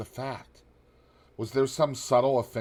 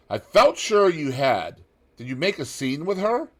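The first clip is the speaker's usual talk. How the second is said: Lord Henry; 8 semitones higher, 8 dB louder than usual. A narrator's reading. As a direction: ask it high and knowing. Lord Henry keeps questioning.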